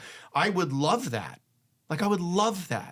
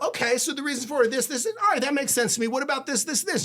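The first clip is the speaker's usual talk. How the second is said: higher-pitched mock wife voice, quizzing; imitating the wife; 10 semitones higher than usual. Wife questioning husband's reasons, voiced higher. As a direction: play the wife poking at each reason.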